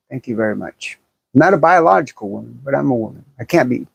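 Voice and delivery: normal talking voice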